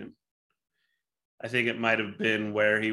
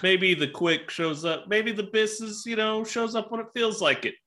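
playful high-pitched voice; goofy joke voice, pitched high; high silly voice; 10 semitones higher than usual. Pretend. Silly joke about Bisquick.

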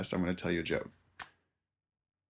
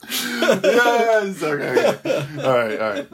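high laughing afterglow; recovering from a laughing fit; still laughing; 11 semitones higher, 5 dB louder, slower than usual. Real, not acted. Comedian recovering after his joke, laughing.

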